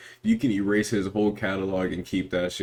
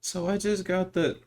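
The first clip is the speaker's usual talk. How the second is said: yapping caller voice; 13 semitones higher than usual. Retold or imitated. Imitating a caller on FaceTime.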